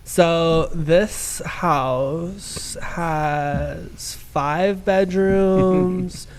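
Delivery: put-on Valley Girl voice with upspeak on each question. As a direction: play a Valley Girl showing off a house. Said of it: "I can do a pretty good Valley Girl impression".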